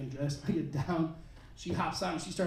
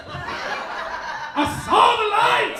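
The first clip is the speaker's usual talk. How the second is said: much higher, very slow rapturous cry; mock preacher; 12 semitones higher, 4 dB louder, much slower than usual. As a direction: stretch it out like a revival preacher. Mock testimony, preacher style.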